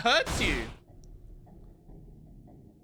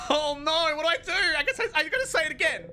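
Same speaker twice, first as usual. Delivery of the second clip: panicked; 10 semitones higher, faster than usual. Real, not acted. Streamer panicking as the king tries again, high and rushed. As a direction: rattle it off in a fluster.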